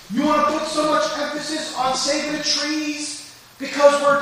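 high, loud mocking rebuke as God; God's voice. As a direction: thunder down as God scolding mankind. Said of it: "God says".

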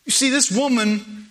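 normal talking voice

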